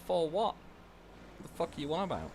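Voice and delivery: high-pitched, incredulous comeback; baffled retort. Retold or imitated. Pupil answering a shouting teacher, acted bafflement. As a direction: throw back a bewildered question.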